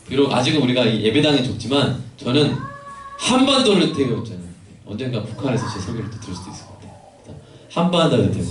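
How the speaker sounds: preacher rousing the youth congregation, loud; full-force preaching. Acted. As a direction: build it up like a revival sermon.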